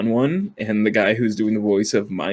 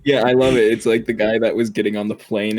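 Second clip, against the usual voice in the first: ordinary delivery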